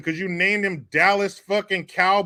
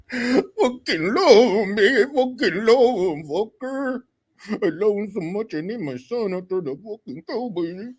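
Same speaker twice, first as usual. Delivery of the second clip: mock crying; 3 semitones higher than usual. Mock-sobbing as the superfan dad.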